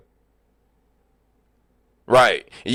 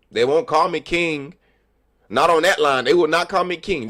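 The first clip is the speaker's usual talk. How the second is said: normal talking voice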